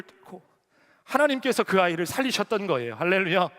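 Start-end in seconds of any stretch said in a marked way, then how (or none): none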